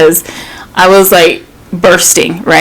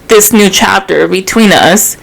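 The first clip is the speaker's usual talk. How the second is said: usual speaking voice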